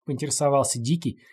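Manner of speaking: ordinary delivery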